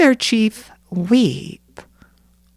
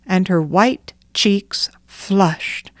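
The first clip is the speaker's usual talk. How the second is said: plain reading voice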